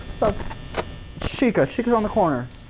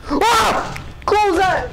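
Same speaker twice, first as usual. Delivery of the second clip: panicked yell; 12 semitones higher, 3 dB louder, much slower than usual. Real, not acted. Jump scare, panicked yelling.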